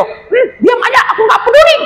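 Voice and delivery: cheeky defiant little creature. Acted. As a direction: snap back that you don't care. Anjani dismisses the parrot.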